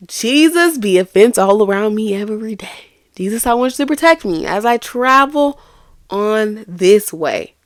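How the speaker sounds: high, loud pleading prayer; fervent prayer. Retold or imitated. Teacher recalls praying for protection, fervent and pleading. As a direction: cry out to Jesus for protection.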